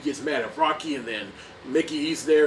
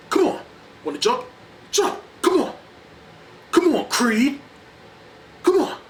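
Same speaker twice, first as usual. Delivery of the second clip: high, loud taunting yells; taunting shout; 11 semitones higher, 7 dB louder than usual. Retold or imitated. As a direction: bait him into the fight. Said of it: "he's all like".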